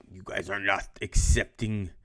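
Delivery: mock Russian accent, lightly put on; Stalin in a teacher's bad Russian accent. Teacher imitating Stalin to the class in a mild Russian accent. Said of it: "It's my best Russian voice".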